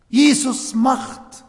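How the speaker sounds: ordinary talk, nothing marked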